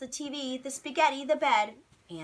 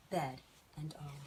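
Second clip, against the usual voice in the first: plain reading voice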